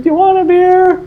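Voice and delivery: high-pitched imitation of calling out; mock mom yell. Buddy's mom offering the guest a drink, high mock yell. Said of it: "she yells down".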